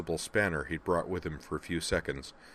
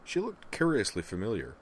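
usual speaking voice

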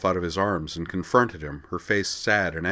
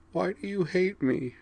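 tearful whisper; 13 semitones higher than usual. Acted. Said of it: "she whispered".

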